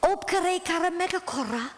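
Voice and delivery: high, light voice for the villager; villager calling out. Retold or imitated. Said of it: "one of the guys says".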